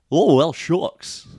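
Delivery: high, slow, drawn-out dopey drawl; goofy cartoon dog. Shown as a demonstration. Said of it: "I can do Goofy".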